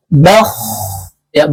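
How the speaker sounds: normal talking voice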